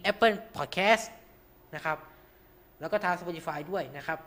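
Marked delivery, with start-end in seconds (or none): none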